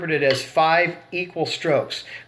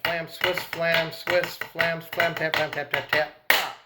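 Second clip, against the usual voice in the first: soft, even spoken drum pattern; rhythmic rudiment count; 5 dB quieter than usual. Shown as a demonstration.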